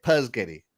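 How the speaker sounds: "Puzgetti" with no opening S, a Z before the G; kid's garbled spaghetti, "Puzgetti"; kids' mispronunciation. Retold or imitated. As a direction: say "Puzgetti" as PUZZ-getty. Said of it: "That's how they say it".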